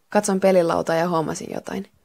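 ordinary delivery, nothing marked

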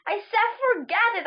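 high, exasperated mild shout. A narrator's reading. Runner cutting off the arguing man, mildly shouted. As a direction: raise the pitch and call it out. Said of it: "I shouted".

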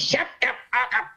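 high mock snap from a grumpy old man; hammed-up grumpy old man barking at a singer; grumpy old star. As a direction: imitate a cranky star cutting him off. Grumpy Mickey Rooney snapping.

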